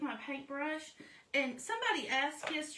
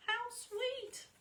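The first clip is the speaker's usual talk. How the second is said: delighted; 11 semitones higher than usual. Real, not acted. Admiring her finished craft.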